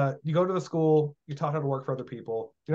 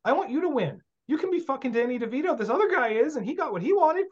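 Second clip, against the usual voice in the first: much higher, insistent pep talk; fired-up coach; 11 semitones higher than usual. Retold or imitated. Telling a student he wants them to win, fired-up coach. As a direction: pump the student up. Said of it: "I'm like".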